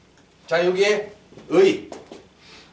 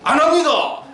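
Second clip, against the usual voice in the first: high, punchy mimicking echo; comic echo; 9 semitones higher, 4 dB louder than usual. Preacher echoes a church member's reply, comic echo. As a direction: throw the answer back high for laughs.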